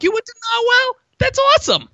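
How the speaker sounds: very high, mock-excited Boston accent; bad Boston accent. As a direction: squeal it in a hokey Boston accent. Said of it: "bad Boston accents".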